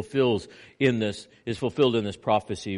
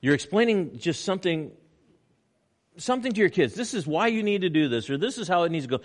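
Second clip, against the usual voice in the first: usual speaking voice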